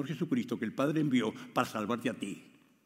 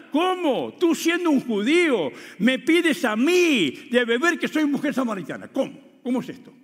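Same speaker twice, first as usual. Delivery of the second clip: higher, louder astonished questions; surprised Samaritan woman; 10 semitones higher, 7 dB louder than usual. Acted. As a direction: act out her disbelief.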